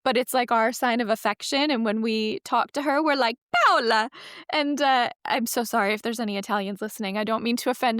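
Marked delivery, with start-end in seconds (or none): 3.5-4.1 "Paola" in a hammed-up fake Italian accent, a touch higher and louder; bad Italian accent. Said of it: "like a really bad Italian accent".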